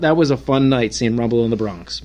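ordinary talk, nothing marked

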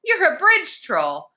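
high, slow, delighted discovery; high-pitched girlish amazement; excited realization. A narrator's reading. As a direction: gasp it out in wonder, high. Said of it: "She was so excited".

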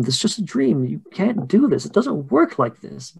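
high, insistent reprimand; pleading mother. Acted. [name] recalls warning the girls, high pleading voice. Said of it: "I told them".

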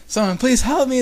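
high, loud cry for help; acted high-pitched plea; yelled plea. A narrator's reading. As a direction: call out desperately, voice way up. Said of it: "the voice yelled again".

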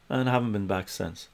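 ordinary delivery

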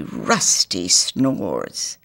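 very slow, low rusty snores; slow snore words. Acted. Doctor Rumor snoring, slow and low. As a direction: drag it out like snoring.

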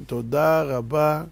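slow, drawn-out "תודה רבה"; slow thanks. Shown as a demonstration. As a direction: stretch every syllable of "תודה רבה". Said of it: "צריך לבוא דבר ראשון להגיד". ("תודה רבה" לאט ובהדגשה)

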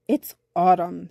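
lightly voiced bumblebee, low and loud; rumbly bumblebee. A narrator's reading. Bumblebee calls on boy with serious news, rumbly. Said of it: "his voice unusually rumbly".

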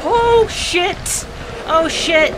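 high, loud frightened cry; panicked yell. Real, not acted. Gamer yelling as monsters close in, panicked. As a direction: shout it in sudden panic.

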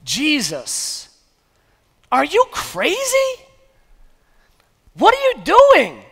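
high, disbelieving cry of alarm; shocked crowd. Retold or imitated. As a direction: play the crowd, aghast and rising. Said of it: "the crowd and the disciples are like".

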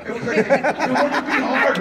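laughing through the words; real amused laughter while telling it. Real, not acted. Recalling the fake-tough extras on set, laughing.

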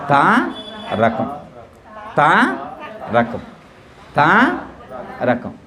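తా held long and rising, pause, then రకం dropping low; word split for repeating. Shown as a demonstration. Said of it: "నేను అన్నట్లే".

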